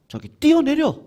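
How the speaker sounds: devil's urging. Acted. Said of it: "마귀가 예수님한테 했던 그 유혹".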